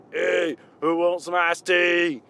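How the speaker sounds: slow, drawn-out rapper voice offering tea. Acted. Imagined rapper offering iced tea to guests, in a slow put-on voice. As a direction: play a sweet rapper, stretching out each word. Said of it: "being sweet with that voice".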